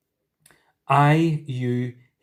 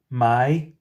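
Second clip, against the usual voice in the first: ordinary talk, nothing marked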